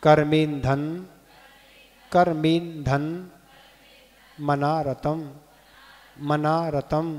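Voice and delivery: each word said separately, measured and deliberate; pronunciation demo. Shown as a demonstration. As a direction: sound out the Sanskrit for pupils to repeat. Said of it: "एक बार और". (एक-एक शब्द का स्पष्ट उच्चारण)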